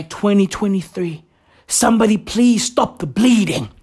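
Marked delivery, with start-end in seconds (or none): none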